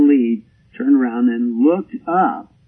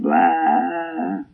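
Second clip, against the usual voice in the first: long drawn-out sheep's "baa"; mock bleat, held out; imitated sheep bleat; 4 semitones higher than usual. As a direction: bleat like a defiant ewe. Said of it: "did one of these".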